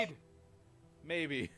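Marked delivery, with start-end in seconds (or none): 0.0-0.2 loud cheering shout. Shown as a demonstration. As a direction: yell it out. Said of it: "say something loud again".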